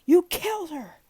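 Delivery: high, loud accusing yell; high enraged shout; furious grieving shout. A narrator's reading. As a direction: scream the blame at him. Grieving old man accuses driver.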